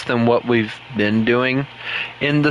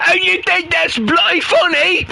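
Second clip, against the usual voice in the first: high, louder hammed-up British accent, indignant; 4 dB louder than usual. Imagined Chris scolding him, hammy British accent. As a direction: play an offended cartoon Brit, British accent. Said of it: "in a British accent".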